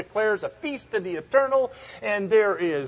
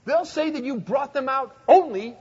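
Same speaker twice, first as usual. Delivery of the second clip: urgent plea; 8 semitones higher, 6 dB louder than usual. Retold or imitated. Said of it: "now his argument is".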